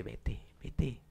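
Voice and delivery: urgent hushed whisper from a window; hushed whispered plea to leave. Retold or imitated. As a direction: whisper so no one hears.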